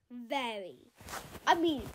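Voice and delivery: ordinary delivery, nothing marked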